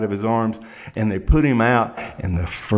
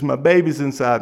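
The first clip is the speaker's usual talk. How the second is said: desperate mother; 6 semitones higher, 4 dB louder than usual. Retold or imitated. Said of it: "she's outside crying and sobbing".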